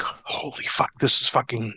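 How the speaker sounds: hushed, voiceless awe, retold; soft, awestruck mock reaction; stunned disbelief. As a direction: replay being stunned, under the breath. Retelling his stunned reaction to news.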